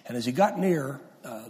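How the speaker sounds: normal talking voice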